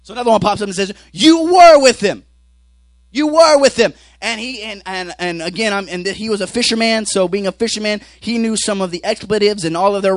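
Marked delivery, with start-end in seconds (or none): 1.1-3.9 high, drawn-out accusing shout; loud accusation. Retold or imitated. Bystander accusing Peter of being with Jesus, loud accusation. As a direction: point the finger with a loud, raised voice.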